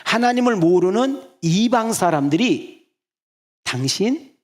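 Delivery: slow, high and loud building sermon voice; preaching. Real, not acted. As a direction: build toward the point, drawing it out. Sermon build-up to key point.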